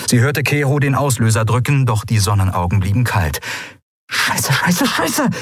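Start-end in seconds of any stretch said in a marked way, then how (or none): 4.1-5.3 rising, high-pitched frantic curse; hissed cursing. A narrator's reading. Pilot finds the weapons dead, hissed cursing. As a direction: hiss the curses, climbing higher each time. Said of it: "zischte Endriel".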